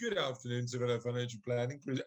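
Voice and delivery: low-pitched posh greeting; posh phone voice. Pretend. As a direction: answer as the firm's posh owner. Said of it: "I'm putting my posh voice on".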